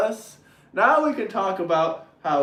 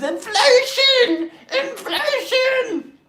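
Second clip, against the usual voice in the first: high, slow mocking wail of the word; hammed-up whiny protester; mock screaming Democrats; much slower than usual. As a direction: stretch it out in a whiny high cry. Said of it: "Democrats were screaming at the top of their lungs".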